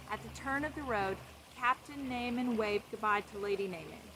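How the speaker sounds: plain reading voice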